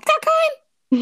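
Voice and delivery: high, stunned disbelief; shocked, voice shooting up; surprised. Real, not acted. Learns Voldemort has none.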